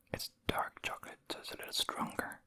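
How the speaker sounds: ordinary talk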